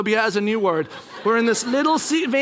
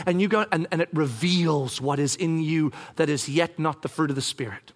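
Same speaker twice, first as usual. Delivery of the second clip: subdued, lower-pitched God voice; God speaking; 4 semitones lower than usual. Acted. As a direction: explain gently as God, voice kept down. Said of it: "God speaks and says".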